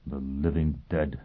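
soft low mumble; mumbled. A narrator's reading. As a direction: mumble it to himself. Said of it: "he mumbled".

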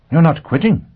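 high, loud disbelieving question; surprised. A narrator's reading. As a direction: blurt it out in disbelief. Prestine surprised.

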